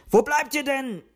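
high, loud impatient call. A narrator's reading. Wuschel shouts into the house for the others. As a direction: call them back from across the garden. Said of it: "rief Wuschel".

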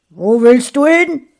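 loud, high, harsh challenge; husband angrily stopping his wife at the door; angry demand. Acted. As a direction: confront her menacingly. Evil husband stops his wife.

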